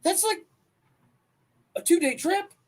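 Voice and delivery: high, loud astonished cry; shocked disbelief. Retold or imitated. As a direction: squeal it in disbelief. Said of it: "Then I looked at it and I was like".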